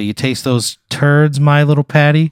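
loud, drawn-out mocking quote; bully's taunt. Bully to his victim after a swirly, taunting.

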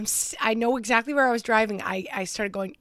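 normal talking voice

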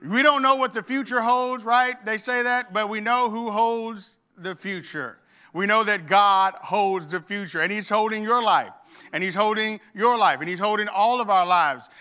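high, loud, emphatic preaching; preaching at full force. Acted. Pastor proclaiming God holds the future, in full preaching voice. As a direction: proclaim it to the congregation. Preacher opening on God's sovereignty.

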